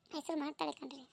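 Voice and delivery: mother crying with pride over her daughter. Acted. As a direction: fade into quiet weeping. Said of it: "ನೀವ್ ಅಳ್ಬೇಡ್ರಿ".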